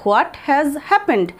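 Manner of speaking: drawn out, every word stretched; very slow. Shown as a demonstration. Teacher repeating slowly so students can copy. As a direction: spell it out slowly for learners. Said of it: "বা এরকমও বলতে পারি".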